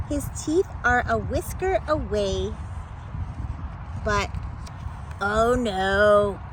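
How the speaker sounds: ordinary delivery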